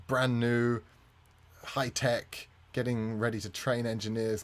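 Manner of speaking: ordinary talk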